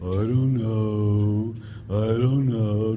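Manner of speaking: slow, low shrugging mumble; mumbled teen answer. Retold or imitated. Priest imitating students' shrug for the congregation, slow. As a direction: drawl it out, lazy and unsure. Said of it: "some of you are the same thing".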